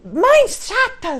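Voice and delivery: put-on high, slow whiny kid voice; bratty child shouting; child's whiny insult. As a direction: mimic a rude child yelling at mom. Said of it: "ele fala".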